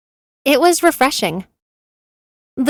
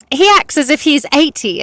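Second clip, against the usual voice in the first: higher-pitched, mildly exclaimed jibe; exclaiming; 5 semitones higher, 3 dB louder than usual. A narrator's reading. Sous chef teasing his boss's manner, mild exclamation. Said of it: "exclaimed Sachin".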